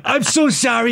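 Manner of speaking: high-pitched acted apology; apologetic character. Character apologizes after blood is spat, high mock pleading. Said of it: "Tina's like".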